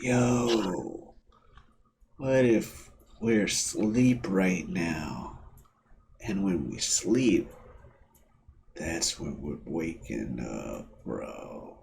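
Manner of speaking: slow, low stoner drawl; spacey stoner, trailing off. Retold or imitated. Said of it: "textbook stoner talk".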